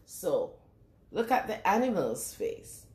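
plain reading voice

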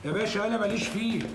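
high, pleading voice that climbs; desperate pleading. Acted. Suspect begs the officer to hear him out, frantic pleading. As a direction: beg the officer frantically to listen.

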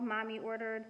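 usual speaking voice

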